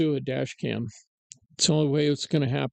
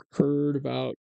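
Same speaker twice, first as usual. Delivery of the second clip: ordinary talk, nothing marked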